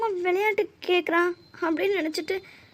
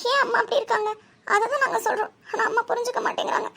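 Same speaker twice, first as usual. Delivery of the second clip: high, upset pleading; tearful complaint; 5 semitones higher than usual. Acted. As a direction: complain through distress, voice lifted. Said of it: "அதை விட்டு அழுதுட்டு இருக்க". (அழுகை கலந்த ஆதங்கத்துடன்)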